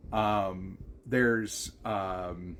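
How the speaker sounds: ordinary talk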